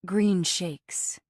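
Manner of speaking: lightly voiced airy new-age woman; slow, drifting earth-mother tone; dreamy hippie voice. A narrator's reading. Moonbliss voiced.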